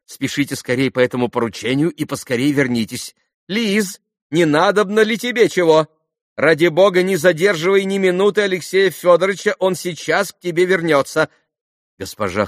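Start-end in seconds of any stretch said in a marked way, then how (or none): 0.1-11.3 high, loud, hurried instructions; flustered excitable mother. A narrator's reading. Khokhlakova tells Lise not to delay Alexei, flustered and high. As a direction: fuss and command while leaving.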